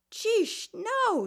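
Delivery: high startled gasp of disbelief; shocked disbelief. Acted. Daughter's disbelief.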